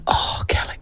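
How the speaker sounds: hushed whisper so as not to wake the puppy; soft unvoiced whisper over a sleeping puppy. Real, not acted. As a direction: breathe it out, barely voiced.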